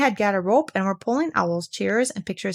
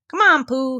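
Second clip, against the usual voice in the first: high, loud eager call; 5 semitones higher, 5 dB louder than usual. A narrator's reading. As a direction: call him along eagerly. Calling out.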